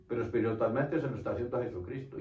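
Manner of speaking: ordinary talk, nothing marked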